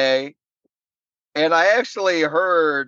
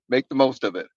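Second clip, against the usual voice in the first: ordinary talk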